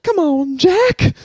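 very high squealed combo impression; Shelley Duvall as Joe Biden. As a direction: plead in a squeaky put-on voice. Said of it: "my Shelley Duvall Joe Biden".

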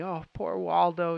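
slow, drawn-out mock pity; Lucy, brokenhearted. Secretary mourning the shot bird, retold with slow pity. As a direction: imitate a mildly heartbroken lament, stretching the words. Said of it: "they're all kind of brokenhearted, especially Lucy".

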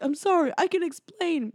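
high, imitated apology of a caught man; mock pleading. Host voices schemer caught out by woman, mock pleading.

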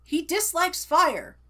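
high, emphatic forbidding voice; stern warden. Retold or imitated. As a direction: bark the ban like a stern guard.

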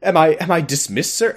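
high, mock-obedient soldier; exaggerated parade-ground compliance; sarcastic. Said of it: "being very sarcastic".

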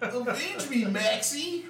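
hammed-up dying Goofy, high; overacted death scene. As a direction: gasp out a dramatic last wish. Joking about Goofy's death.

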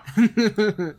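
amused, bubbling chuckle; short run of real giggly laughter. Real, not acted. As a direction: laugh lightly at the joke. Amused after demanding the figure.

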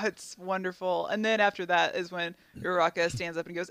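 normal talking voice

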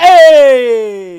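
long, high, very loud call; drawn-out shout imitating the doctor; mock holler. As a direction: holler it out, hammed-up. Hammed-up doctor hollering after patient.

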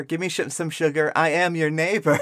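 usual speaking voice

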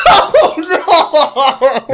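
loud, high laughing wail; helpless laughing. Real, not acted. Reader hits a favourite fanfic sentence, helplessly laughing. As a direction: wail it out while cracking up.

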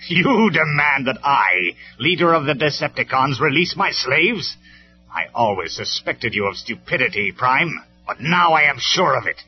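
mock your enemy's demand with grand contempt; derisive villain. A narrator's reading. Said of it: "Megatron laughed in derision".

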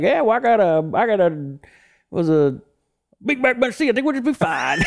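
a light Southern twang, pitched high; lightly voiced country tow driver; Southern accent. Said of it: "He's like".

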